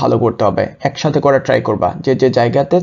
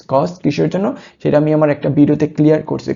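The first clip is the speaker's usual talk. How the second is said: normal talking voice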